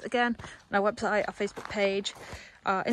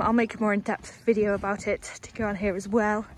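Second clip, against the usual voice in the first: normal talking voice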